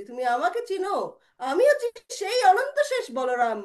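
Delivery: high, loud, ringing announcement; insistent proclamation. Acted. Balaram insisting mother recognise him, high ringing declaration. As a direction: drive the identity home. Boy insists on divine identity.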